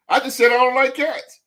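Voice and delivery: high, laughing protest; a player scrambling to explain himself, laughing; flustered denial. Retold or imitated. Player protesting to the media, flustered and laughing. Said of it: "And you like".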